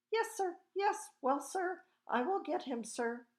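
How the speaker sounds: high-pitched, flustered yessing; flustered, deferential father. A narrator's reading. Father on the phone to an important caller, flustered and deferential.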